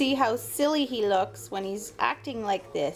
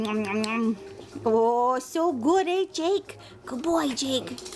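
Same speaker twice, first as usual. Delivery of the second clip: normal talking voice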